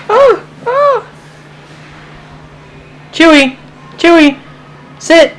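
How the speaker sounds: high, loud calls of the dog's name; high pet call. Real, not acted. Owner calls her puppy Chewy in a high pet voice.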